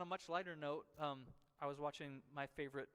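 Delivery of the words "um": usual speaking voice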